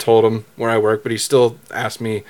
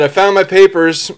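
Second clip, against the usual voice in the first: mildly raised, higher-pitched call; half-hearted imitation of calling out; retold yell; 6 semitones higher than usual. Driver calls to the officer, mild retold yell. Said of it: "I yell out the window".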